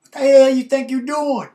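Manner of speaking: loud, high angry shout; furious ex shouting down the hallway; retold yell. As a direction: bellow it at the guy with his girl. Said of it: "he didn't say friggin'".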